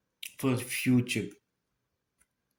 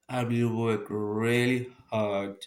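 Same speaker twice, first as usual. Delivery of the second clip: ordinary talk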